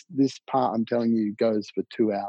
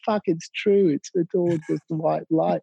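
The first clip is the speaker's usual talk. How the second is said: higher-pitched retold astonishment; amazed realisation; 6 semitones higher than usual. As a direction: relive the moment it dawned on you. Re-enacting amazement at seeing the light.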